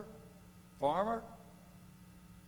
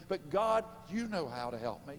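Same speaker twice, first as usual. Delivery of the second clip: building prayer; 6 semitones higher, 8 dB louder than usual. Pretend. Builds model prayer to climax.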